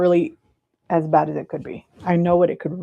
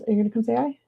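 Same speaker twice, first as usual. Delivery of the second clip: ordinary talk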